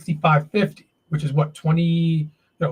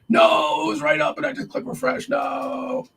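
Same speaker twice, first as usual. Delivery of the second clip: dismayed high yelp; 12 semitones higher than usual. Real, not acted. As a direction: yelp as the chart disappears. Option depth vanished on refresh.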